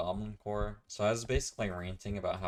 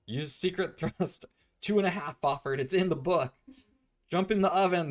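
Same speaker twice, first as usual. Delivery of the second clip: high, loud, frantic instructions; acted urgent shouting; 11 semitones higher, 9 dB louder than usual. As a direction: shout hammed-up orders mid-fight. Acted urgent scene from the story.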